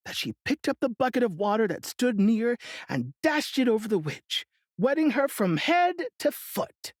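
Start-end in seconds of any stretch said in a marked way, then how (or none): none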